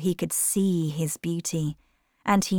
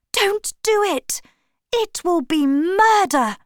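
much higher, urgent pleading cry; desperate shout; 13 semitones higher, 5 dB louder than usual. A narrator's reading. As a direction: yell to stop him. Pulling knife from painter.

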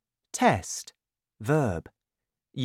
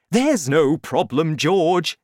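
loud, higher, brushing it aside; too excited to listen; 6 semitones higher, 7 dB louder than usual. A narrator's reading. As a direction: wave away your son's worry. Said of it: "Mister Cranky was too excited".

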